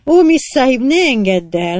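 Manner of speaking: high, loud plea; pleading cry. Acted. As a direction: beg her not to let him go. Woman pleads with Kate.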